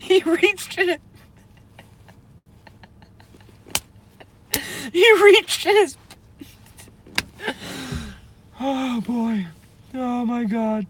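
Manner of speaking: high squeaky voice cracking with laughter; words squeezed out through giggles; laughing fit. Real, not acted. Streamer recounting the turtle moment, high laughing fit. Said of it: "I can't".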